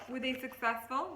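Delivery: ordinary talk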